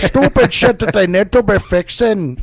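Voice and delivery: put-on old Irishman, lilting brogue; hammy old Irish accent. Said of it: "That is an old Irishman".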